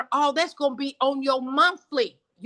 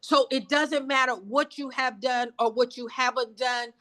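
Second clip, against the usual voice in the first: ordinary talk, nothing marked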